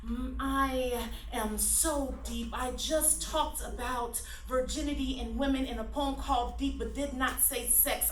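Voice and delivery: ordinary delivery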